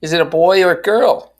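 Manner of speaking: high, loud, dainty question; delicately. A narrator's reading. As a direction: ask it with mock daintiness. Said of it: "she asked delicately".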